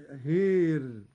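هار said with the alif leaned to e, hir; imala on هار. Shown as a demonstration. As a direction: tilt the alif of هار toward i. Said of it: "مع إمالة الألف التي بعد الهاء".

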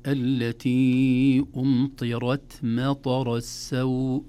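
slow recited التي أمطرت مطر السوء, R heavy, light aspirated T; heavy R, whispered T. Shown as a demonstration. Teacher demonstrating the verse, R heavy and T lightly aspirated. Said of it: "حرف التاء مهموس مرقق".

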